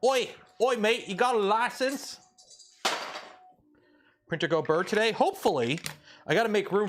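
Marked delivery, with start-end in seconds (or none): 0.1-2.2 higher-pitched British "oi, mate" challenge; mock British accent. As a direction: put on a hammed-up British street accent. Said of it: "How, how do British".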